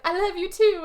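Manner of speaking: high-pitched, drawn-up reply; mock tearful farewell. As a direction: imitate her emotional goodbye in a high voice. Retold woman answering, tearful farewell.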